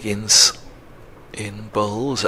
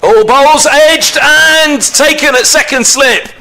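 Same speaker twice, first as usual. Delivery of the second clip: cricket commentator; 6 semitones higher than usual. Real, not acted. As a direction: rise up to call the wicket. Wicket falls on live commentary.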